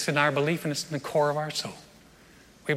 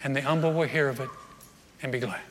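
scripture reading; 4 semitones lower, 3 dB quieter than usual. Acted. Reads Psalm 34.

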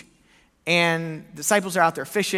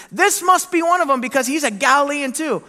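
raised, insistent; man insisting Peter is Galilean, louder; insisting accuser; 9 semitones higher, 7 dB louder than usual. Acted. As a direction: push the point hard. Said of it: "someone else insisted".

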